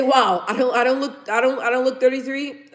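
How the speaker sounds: ordinary delivery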